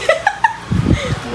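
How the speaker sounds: squealing laugh; high laugh. Real, not acted. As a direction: burst into a high-pitched laugh. Laughing at dog.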